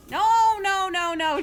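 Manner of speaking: loud, high string of denials; emphatic protest. Acted. Streamer insisting to chat on her character pick, emphatic protest. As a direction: wave off objections loudly.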